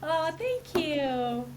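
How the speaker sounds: high, drawn-out mock thanks; mock gratitude. Co-host answering teasing about her accent, playfully exaggerated thanks. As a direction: stretch out a playful thank-you.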